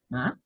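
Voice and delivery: "Ma" softly rising like a question; second tone. Shown as a demonstration. Teacher repeating the second tone for students, rising "Ma". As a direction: ask "Ma" with a quiet upward glide. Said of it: "Ese es el segundo tono".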